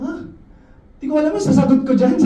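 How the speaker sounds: flustered surprise. Retold or imitated. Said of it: "Sabi ko".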